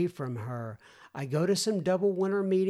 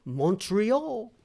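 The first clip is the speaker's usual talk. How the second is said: put-on "Montreal" in a half-hearted French accent; attempted French accent; 4 semitones higher, 3 dB louder than usual. Host naming a listener's city, amateur French accent. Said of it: "Is that a good French accent?".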